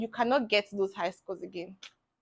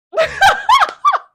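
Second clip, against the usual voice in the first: loud, high-pitched burst of laughter; laughing; 13 dB louder than usual. Real, not acted. Student laughing at being called a failure, loud high laugh. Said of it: "I'm laughing, but it's not funny".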